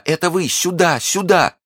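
high, urgent calls; short high cries of joy; joyful cry. Acted. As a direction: call them over in great excitement. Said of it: "закричала она".

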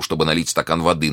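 plain reading voice

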